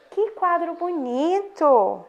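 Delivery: slow, admiring exclamation; exclamation tune. Shown as a demonstration. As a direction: stretch it out like you're amazed. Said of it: "Observa a entonação que a professora tá dando".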